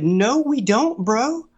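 incredulous denial. Retold or imitated. Retold denial to friends.

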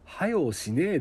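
showing listeners the blunt Okayama way to say hurry up; Okayama dialect. Shown as a demonstration. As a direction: say はよしねえ like a local from Okayama. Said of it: "ですよ".

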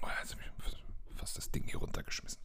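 hushed startled whisper. Real, not acted. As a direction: whisper it after a near-drop. Nearly knocked something over.

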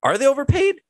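high, rising disbelief; incredulous challenge. Real, not acted. Host challenges the critics, high incredulous rise. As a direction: throw the question back in mock shock.

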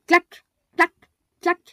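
loud, high clack clack clack; clacking steps. A narrator's reading. Narrator voices the mole's stick tapping, loud. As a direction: make the footsteps ring out loud and high. Narrator imitates approaching footsteps.

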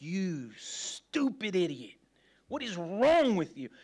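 drawn-out mock self-scolding, rising on the question; berating himself. Man's inner monologue after he messes up, mock scolding. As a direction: act out a harsh inner critic. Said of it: "the monologue that happens in my mind is like".

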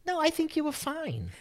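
put-on the girl, high-pitched. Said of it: "she was like".

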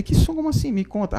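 a jump way up in pitch, surprised; surprised asking. Retold or imitated. Man asking girlfriend about her dream: high, surprised. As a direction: caught off guard, pressing for details.

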